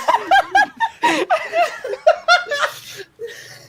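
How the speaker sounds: high, falling fit of laughter. Real, not acted. GM keeps laughing.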